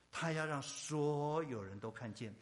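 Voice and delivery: ordinary delivery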